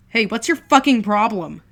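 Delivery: loud, high furious yell; furious yell at the brother who slapped him; angry shout. Acted. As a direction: snap at him full force.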